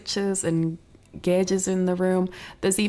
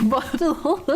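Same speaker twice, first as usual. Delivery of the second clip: breaking into high giggles on the word; real giggling; 12 semitones higher than usual. Real, not acted. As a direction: crack up laughing as the sentence ends. Cracks up at own distraction.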